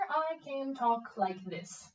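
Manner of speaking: slow, each word separated; slow deliberate articulation demo; slow articulated demo. Shown as a demonstration. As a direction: spell it out slowly.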